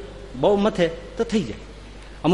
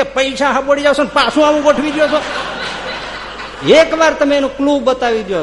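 higher and louder, laughing through the grievance; laughing complaint; 9 semitones higher, 6 dB louder than usual. Retold or imitated. Customer on the phone begging the company for the clue, laughing. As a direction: complain to the company while cracking up.

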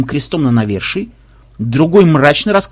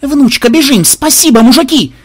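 high, loud, urgent; exhilarated holler; excited yelling; 10 semitones higher, 6 dB louder than usual. A narrator's reading. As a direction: shout in a rush to leave. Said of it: "завопил ксенобайт".

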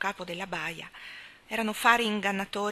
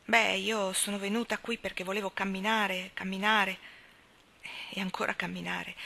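ordinary delivery, nothing marked

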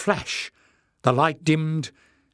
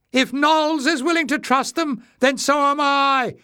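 very high, loud piping voice; high-pitched call; 6 dB louder than usual. A narrator's reading. Voice in the crowd siding with Knowles, high-pitched call. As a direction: pipe up at the top of your range. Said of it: "called a high-pitched voice".

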